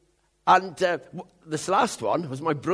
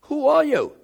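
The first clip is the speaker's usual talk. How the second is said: higher and louder, who hammered; ringing question; 10 semitones higher, 9 dB louder than usual. Acted. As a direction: lift it into a ringing cry. Repeats question, climax.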